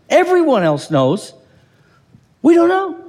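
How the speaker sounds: rising to a loud, high we don't know; emphatic contrast. Real, not acted. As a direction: punch we hard against everyone else.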